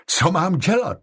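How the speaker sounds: high, distressed question; vyděšeně. A narrator's reading. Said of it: "vyděšenou tváří". (vyděšená, vysoko položená otázka)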